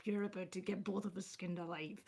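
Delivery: a soft warning in a friend's ear; urgent whisper in the ear. Acted. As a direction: hush it right into his ear. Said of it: "in his ear".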